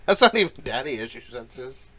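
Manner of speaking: ordinary delivery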